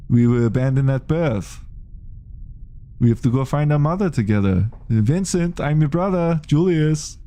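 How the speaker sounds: high comedy Schwarzenegger voice; comedy Arnold as Julius. Retold or imitated. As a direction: ham up Arnold playing Julius. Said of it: "I can do a, a Julius".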